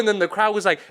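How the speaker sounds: normal talking voice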